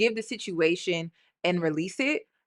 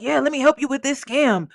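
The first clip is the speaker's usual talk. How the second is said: higher, lightly mocking employee voice; eager-to-please employee; 4 semitones higher than usual. Host mimicking the mom as a bought-off employee, mocking. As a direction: play the paid helper going along with it. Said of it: "she sound more like an employee".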